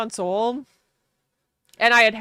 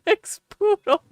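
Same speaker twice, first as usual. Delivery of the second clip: laughing; 10 semitones higher, 5 dB louder, slower than usual. Real, not acted. Laughing through dog story.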